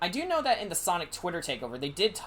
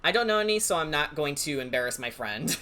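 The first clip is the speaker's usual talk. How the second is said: usual speaking voice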